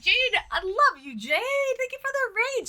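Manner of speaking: high, excited affection; gushing thanks. Real, not acted. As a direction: squeal your love and thanks to a friend.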